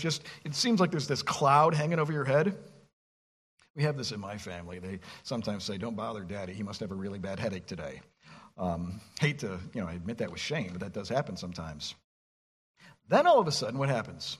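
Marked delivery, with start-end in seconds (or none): 3.8-11.9 lower, softer admission; sheepish low aside. Real, not acted. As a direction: drop low and soft, half ashamed. Sheepish aside about his family.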